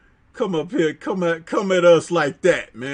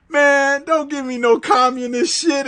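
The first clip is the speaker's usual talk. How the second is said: a hint of a tough guy, pitched higher; tough guy retort; 7 semitones higher, 3 dB louder than usual. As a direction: throw the line out like a movie tough guy. Host acts out fighter's retort.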